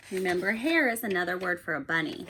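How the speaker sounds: animated picture book read-aloud; reading to children. A narrator's reading. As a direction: read it like bedtime story time for a class. Teacher reads picture book aloud.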